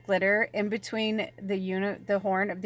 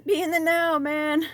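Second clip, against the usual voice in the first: hippie preacher; 9 semitones higher, 5 dB louder than usual. Pretend. Preaching living in the moment.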